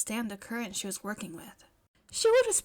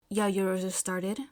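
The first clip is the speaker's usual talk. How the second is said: ordinary delivery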